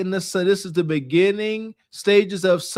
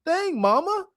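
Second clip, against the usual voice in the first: put-on higher, whiny little-kid voice; imitating the kid; 7 semitones higher than usual. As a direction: play the son complaining to mom. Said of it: "that kid, if you ask me, was right".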